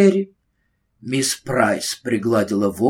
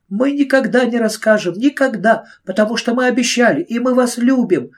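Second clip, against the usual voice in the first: put-on pleading girl; 10 semitones higher, 4 dB louder than usual. Carrie pleading.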